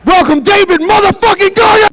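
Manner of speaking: huge, high announcer yell; roaring welcome to a crowd; hype shout. Acted. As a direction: blast it like a ring announcer.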